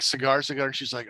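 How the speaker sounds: usual speaking voice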